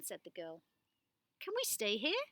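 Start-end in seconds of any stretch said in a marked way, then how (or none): 1.4-2.2 hopeful question. Acted. Alma hopeful.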